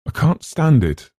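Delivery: can't bear it any longer; distressed. Acted. Eleanor's horror.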